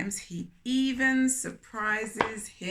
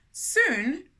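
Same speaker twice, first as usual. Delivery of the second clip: usual speaking voice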